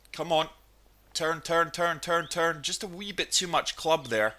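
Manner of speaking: ordinary delivery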